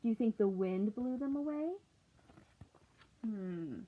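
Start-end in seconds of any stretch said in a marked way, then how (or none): none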